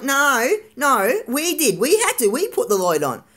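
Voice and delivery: mock emphatic protest; imitated stubborn insistence. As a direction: insist emphatically, as if mimicking an argument. Acting out dad insisting in argument.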